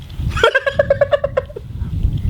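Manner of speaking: very high bright giggle; girl's giggle. Acted. The little girl sees the bat's grin, high giggle. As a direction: burst into a helpless girlish giggle.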